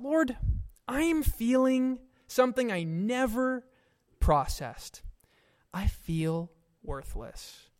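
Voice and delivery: high opening, then sinking soft and slow into worthless; quiet confession. Retold or imitated. Preacher recalling praying to the Lord about feeling worthless, fading and slow. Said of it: "I thought".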